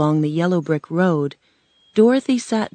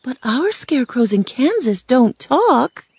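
put-on higher-pitched, puzzled girl; surprised; 7 semitones higher than usual. A narrator's reading. Girl to a talking scarecrow, surprised. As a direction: lift the voice in mild amazement. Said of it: "looked at the scarecrow in surprise".

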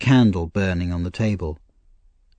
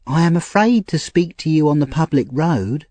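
put-on Nancy, frightened young woman; 8 semitones higher, 3 dB louder than usual. Nancy voiced high, fearful.

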